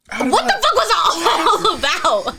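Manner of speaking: loud, high, slow disbelief with laughter; half-laughing indignant outburst; retold outrage. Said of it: "That shit made me mad".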